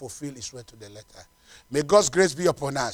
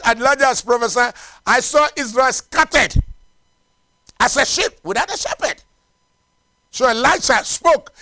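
raised, driving sermon storytelling; forceful preaching; 9 semitones higher, 9 dB louder than usual. Real, not acted.

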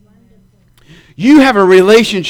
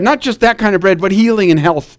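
normal talking voice

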